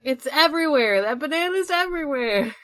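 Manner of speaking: loud, high, delighted outburst; gleeful high exclamation; excited. Real, not acted. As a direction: shout it out, thrilled at the silly banana. Excited outburst about banana.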